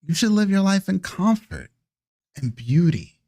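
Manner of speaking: drawn-in, persuasive elder; alluring vampire elder. Acted. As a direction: sell the lovely life gently. Vampire elder tempts the player.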